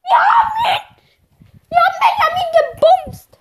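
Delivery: high-pitched laughing cheer; gleeful celebration. Real, not acted. Celebrating with the players after catching Benjamin, gleeful high cheer. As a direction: crow in triumph, voice way up high.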